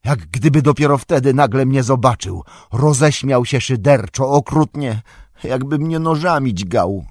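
high, loud, distressed retelling; agitated hobbit reliving his ordeal. A narrator's reading. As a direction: recount the torment with mounting urgency. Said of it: "słowa jego brzmiały coraz wyraźniej i donośniej".

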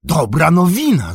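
high, loud exclamation; joyful cry. A narrator's reading. Old Cotton hears of the uprising, crying out. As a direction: cry out at welcome news. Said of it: "zawołał Koton".

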